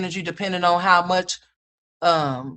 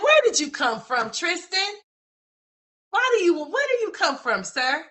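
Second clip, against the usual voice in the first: baffled mom; 12 semitones higher than usual. Retold or imitated. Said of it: "I'm like".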